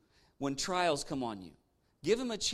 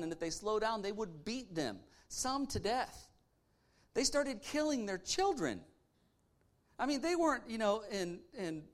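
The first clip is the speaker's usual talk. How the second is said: impassioned preaching; 4 semitones higher than usual. Acted. Preacher builds to passion.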